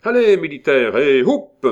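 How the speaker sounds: loud, high cheer-on; rousing call. A narrator's reading. Calling Militaire up to give his speech, rousing shout. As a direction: send them off with a big shouted heave.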